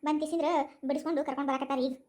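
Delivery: normal talking voice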